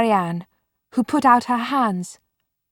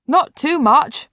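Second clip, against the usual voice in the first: loud, slower call through the wall; calling from another room; 7 semitones higher, 6 dB louder, slower than usual. Acted. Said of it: "Mark's voice called from the other room".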